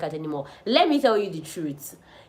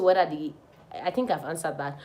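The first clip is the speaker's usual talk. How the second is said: ordinary talk, nothing marked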